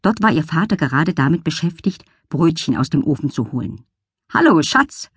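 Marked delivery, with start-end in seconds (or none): 4.3-5.1 higher, bright called greeting; cheerful call. Acted. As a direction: call it out happily. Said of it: "rief er".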